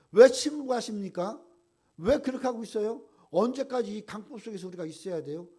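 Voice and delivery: high, loud demanding why; Habakkuk's protest. Retold or imitated. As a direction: confront God loudly. Voicing Habakkuk's complaint.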